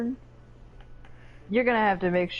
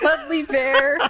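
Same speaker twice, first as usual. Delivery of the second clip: gleeful echo; 8 semitones higher, 6 dB louder, slower than usual. Real, not acted. Echoes the silly name.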